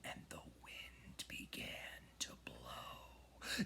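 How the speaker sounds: breathy whispered narration; eerie whisper as the magic starts. A narrator's reading. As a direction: hush it like wind rising. Spooky narration of magic wind.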